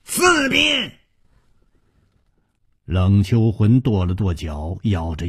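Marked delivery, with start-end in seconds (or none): 0.1-0.9 very high-pitched strained cry; shouted whip count, pitched way up; sharp yell. A narrator's reading. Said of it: "喝道".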